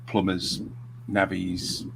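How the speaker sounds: ordinary delivery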